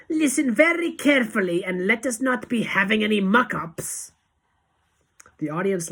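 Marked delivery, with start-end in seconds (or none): 0.1-4.1 high, loud stern warning; Grand High Witch snap. A narrator's reading. Witch leader warning her followers, snapped order. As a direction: order them sharply to pay attention. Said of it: "snapped the Grand High Witch".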